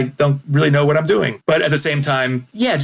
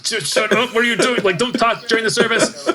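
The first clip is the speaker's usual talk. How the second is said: scolding wife; 8 semitones higher than usual. Retold or imitated. Wife hushing daughter in church.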